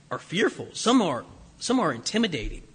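ordinary talk

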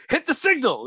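high-pitched barked order; Commissioner Gordon shouting. Retold or imitated. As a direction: yell it like the commissioner. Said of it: "Commissioner Gordon goes".

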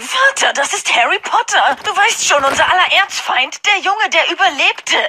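hohe, näselnde Schnöselstimme; Draco-Stimme. Acted. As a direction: wie ein verwöhnter reicher Junge. Draco stellt Harry vor. (hohe Draco-Stimme)

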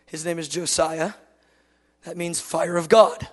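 ordinary talk, nothing marked

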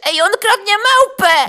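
high, loud yell of alarm; yelling woman. Acted. Fat woman in the crowd yelling that he is stealing the monkey. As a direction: shriek to raise the alarm. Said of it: "zaczęła się drzeć".

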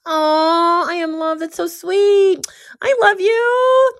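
high, slow, syrupy "I love you"; mock sweet gushing. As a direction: gush sweetly, mocking the naive listener. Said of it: "what do you do?".

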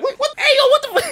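high, loud mock outrage; Bernie yelling. Bernie chasing Jordan, high loud outraged yell. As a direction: shout it like an angry uncle giving chase. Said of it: "Bernie like".

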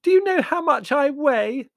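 high, loud, slow indignation; girl's loud high-pitched protest; outraged squeal. Acted. Margot's squeaked, outraged retort.

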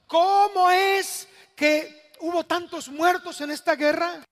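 loud, high cry to God; impassioned acted lament, building question by question; anguished questioning. Acts out Jeremiah questioning God.

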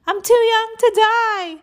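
high, stretched-out wail of panic; panicked yell. A narrator's reading. Kid fleeing monsters in the hallway, yelling in panic. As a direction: wail it loud and high, drawing it out.

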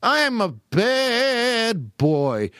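put-on high, drawn-out naughty-boy wail; cartoon character. Cartoon mimics the comedian's catchphrase, high and slow. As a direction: stretch each word in a high cartoon whine. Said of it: "just cartoon characters".

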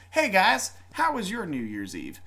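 ordinary talk

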